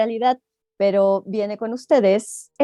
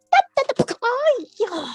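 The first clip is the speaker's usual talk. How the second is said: high crash ta ta; 13 semitones higher than usual. A narrator's reading. Rabbit crashing again.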